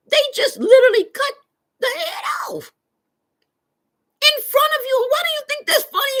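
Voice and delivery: high, loud, near crying; distressed high cry. Retold or imitated. Streamer retelling horror at her mother. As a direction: plead in horror. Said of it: "I said".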